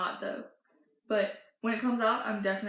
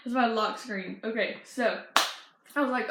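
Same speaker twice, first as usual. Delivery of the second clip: ordinary talk, nothing marked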